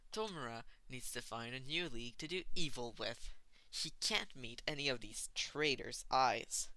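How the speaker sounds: ordinary delivery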